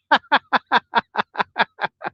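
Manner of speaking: a high burst of real laughter; a genuine high laugh breaking out. Real, not acted. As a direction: laugh hard at your own joke. Laughs at own joke.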